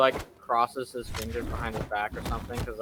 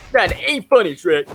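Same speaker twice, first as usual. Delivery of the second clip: higher, louder whiny complaint; whiny Donkey; 7 semitones higher, 9 dB louder than usual. Retold or imitated. Donkey complaining to Shrek, high whine. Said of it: "it's like".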